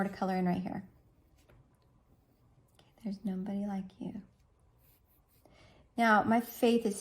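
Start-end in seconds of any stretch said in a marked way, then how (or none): 2.8-4.2 quick breathy whisper of the phrase. Shown as a demonstration. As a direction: whisper it fast as you write. Reads the card phrase aloud.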